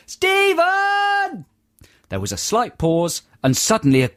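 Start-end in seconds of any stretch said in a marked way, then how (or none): 0.1-1.5 long high call of Stephen!; shouted name. Retold or imitated. Man calling a lost friend in a busy room, long shout. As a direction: call out Stephen! across a noisy crowd. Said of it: "performed a perfect".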